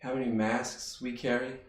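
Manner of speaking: quiet reflection. Acted. Quiet reflection after the shift.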